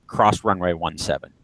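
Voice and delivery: ordinary talk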